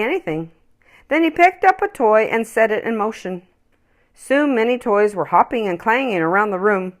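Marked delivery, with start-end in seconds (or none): none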